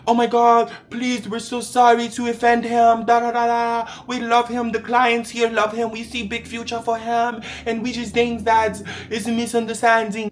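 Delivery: high, loud, gushing apology voice; mock the agents begging him to stay; frantic pleading agents. Agents begging him not to leave, frantic and gushing.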